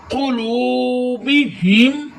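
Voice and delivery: قلوبہم very slow, long lu and chesty ha; drawn-out قلوبہم on a held note; ha from the chest. Shown as a demonstration. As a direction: recite قلوبہم stretching lu, pushing ha from the chest. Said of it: "ہا کو سینے".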